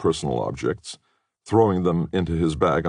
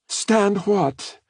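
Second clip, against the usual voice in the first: put-on much higher young woman's voice, calling out; a startled girl calling from the next room; Katie's voice from another room; 3 dB louder than usual. A narrator's reading. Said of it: "asked Katie's voice".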